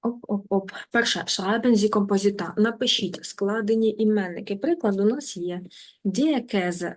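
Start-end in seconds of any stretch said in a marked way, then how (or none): none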